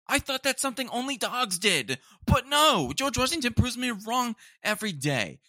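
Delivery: high, loud exasperated rant. Real, not acted. Griping about roommate's cat, high exasperated rant.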